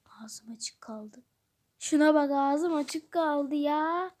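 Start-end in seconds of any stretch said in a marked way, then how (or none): 0.0-1.2 slow, amazed whisper; hushed, slowed-down gasp of amazement; awed whisper. Acted. Amazed at toys. 2.3-4.2 "ağzım açık kaldı" stretched out slowly at normal volume; dragged-out, marveling "ağzım açık kaldı ya"; slow amazement. Acted. Amazed at toys.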